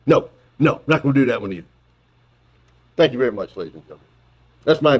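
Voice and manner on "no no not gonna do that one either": ordinary talk, nothing marked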